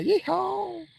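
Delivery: high hammed-up cowboy holler; cowboy yeehaw. Streamer mocking the game's villagers, with a high cowboy yeehaw. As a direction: toss off a silly cowboy yeehaw. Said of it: "Somebody wanted me to do a cowboy vo".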